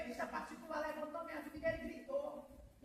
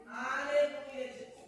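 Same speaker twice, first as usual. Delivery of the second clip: drawn-out praise; 9 dB louder, much slower than usual. Real, not acted. Preacher praising mid-testimony.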